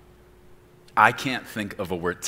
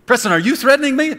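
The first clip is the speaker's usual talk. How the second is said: high, loud, fast mock outrage; offended listener; 14 dB louder, faster than usual. Imagined listener accusing the preacher, high mock outrage. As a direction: fire it back like a shocked listener.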